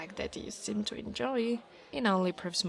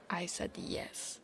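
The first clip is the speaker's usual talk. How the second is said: whispering; 3 semitones lower, 10 dB quieter, slower than usual. Acted. Shy hypothetical admission.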